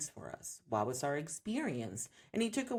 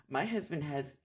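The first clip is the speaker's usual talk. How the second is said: ordinary delivery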